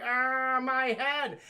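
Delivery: hammed-up groan of injury; mock pain. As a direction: imitate a comic dying wail. Voices beheaded Pamela.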